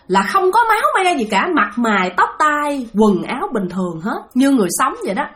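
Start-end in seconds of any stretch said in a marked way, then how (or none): none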